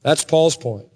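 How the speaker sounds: normal talking voice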